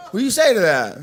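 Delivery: ordinary delivery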